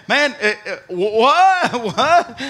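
loud, high stammering what; stunned. Retold or imitated. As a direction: splutter in disbelief. Peter stunned by Jesus' answer.

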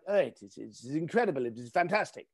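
a bored Italian curator, lightly put-on accent. Curator brushing off the host, in a mild mock Italian accent. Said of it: "Apologize about the accent".

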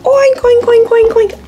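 high excited oinking; mama pig oinks. Pretend. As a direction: oink like a mother pig coming. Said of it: "Here comes Mama Pig".

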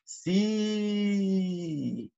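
"Sí" alargado y sonriente; kinema de la I. Shown as a demonstration. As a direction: estira la I de "Sí". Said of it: "evoco la palabra sí". ("Sí" con la I bien marcada)

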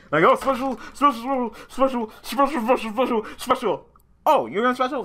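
high, loud mocking chant of special; mocking singsong. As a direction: parrot the opponent's endless summons in a taunting voice. Mocking the opponent's repeated summons.

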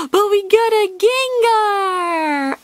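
high, drawn-out thrilled cry; gasp, then squeal it out slowly with delight; real high-pitched excitement; excited squeal. Real, not acted. Thrilled at pulling a card.

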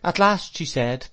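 ordinary delivery, nothing marked

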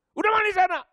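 high, loud shout telling him to bathe; father's shouted order. Retold or imitated. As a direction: yell it like an impatient dad.

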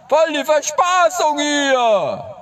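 high, slow silly squeak; squeaky falsetto. Pretend. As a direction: drag it out in falsetto. Joking comment in put-on voice.

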